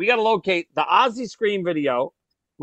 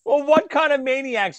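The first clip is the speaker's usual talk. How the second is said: ordinary delivery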